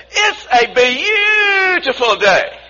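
high, slow joyful cry with beautiful stretched out; imitating exuberant child. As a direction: imitate a little boy gleefully greeting the morning. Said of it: "he has this beautiful outlook on life".

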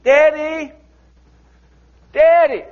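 put-on high, loud child's cry; little child searching for daddy; child calling for daddy. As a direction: call out like a lost child. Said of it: "this little child's".

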